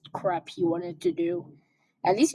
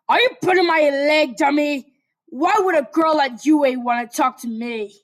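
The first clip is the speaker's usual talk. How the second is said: loud, higher scoffing; gruff Katsuki; 6 semitones higher, 7 dB louder than usual. Acted. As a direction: scoff in disbelief.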